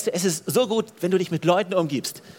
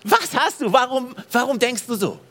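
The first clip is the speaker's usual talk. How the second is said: curious onlookers; 6 semitones higher than usual. Retold or imitated. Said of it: "wenn Leute dein Leben anschauen und sagen".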